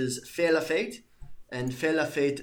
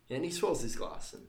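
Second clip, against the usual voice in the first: ordinary talk, nothing marked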